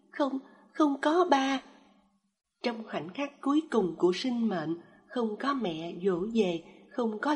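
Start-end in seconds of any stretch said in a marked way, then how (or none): 0.1-1.6 high, slow broken sobbing words; a dying child sobbing out a denial. A narrator's reading. Dying child sobbing to his mother, high and slow. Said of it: "nức nở nói".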